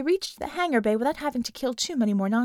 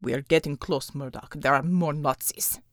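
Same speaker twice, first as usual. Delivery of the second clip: lower, grunting soldier; gruff, clipped warning; low gruff character voice; 8 semitones lower than usual. Acted. Unter warning Murdoch about Nazis, low and gruff.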